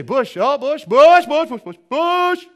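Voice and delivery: high, loud blaming whine of Bush; mocking whiny complainer. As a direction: lampoon a critic shouting Bush over and over. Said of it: "But he'll say".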